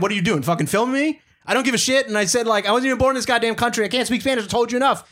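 fired-up retold rant at a customer; retold angry outburst.

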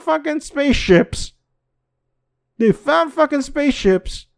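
high-pitched, incredulous rant at the audience; excited rant. Real, not acted. Vlogger ranting to viewers about the news, high-pitched and excited. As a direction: shout your disbelief to the viewers, way up high.

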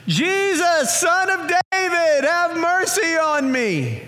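high, loud cry for mercy; desperate shout. Acted. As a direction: cry out to Jesus with everything you have. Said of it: "he begins to shout".

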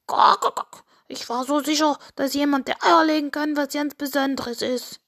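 clucks, then a high rooster lament; disappointed rooster. Acted. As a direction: complain up high as a let-down cartoon rooster. Disappointed rooster after failed audition.

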